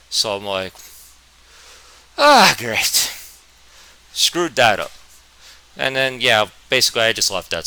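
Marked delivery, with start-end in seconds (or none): 2.2-3.1 sarcastic. Retold or imitated. Sarcastic reaction to failure.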